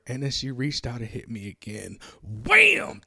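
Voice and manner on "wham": punch sound effect. Retold or imitated. Retelling the second punch.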